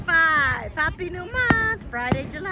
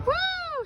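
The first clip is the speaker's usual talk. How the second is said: high but softer whoop; soft cheering woo; 12 semitones higher, 5 dB quieter than usual. Real, not acted. Watching the fireworks shine, soft high whoop. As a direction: give a high, light woo.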